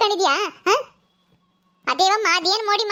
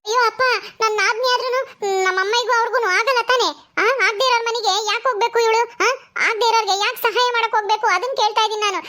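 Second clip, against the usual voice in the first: a grown daughter quarrelling with her father, stung; upset daughter; 2 semitones higher than usual. Acted. Daughter Netra protests to her father, hurt and indignant.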